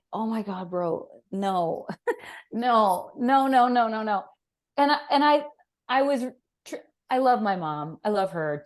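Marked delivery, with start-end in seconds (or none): 0.1-4.2 retold frantic no. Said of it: "And I'm like".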